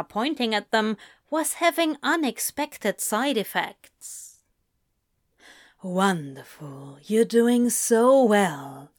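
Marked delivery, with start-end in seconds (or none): 5.8-8.9 slow praising. A narrator's reading. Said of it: "Crowley praised".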